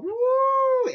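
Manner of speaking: long, very high joyful whoop; gleeful woo. Retold or imitated. Preacher picturing the cliff jump in heaven, joyful whoop. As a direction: shout woo like you're falling free.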